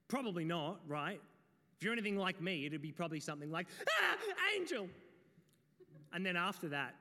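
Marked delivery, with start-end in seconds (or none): 3.9-4.9 high, loud comic yelp of fright; mock startled scream. Startled shepherd yelping at the sudden angel. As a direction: shriek like you just saw an angel.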